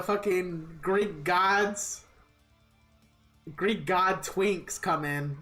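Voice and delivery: usual speaking voice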